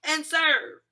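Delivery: high, loud, indignant complaint; mad at cops. Retold or imitated. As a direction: berate them with wounded outrage, rising in volume. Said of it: "I was just still so mad at the cops".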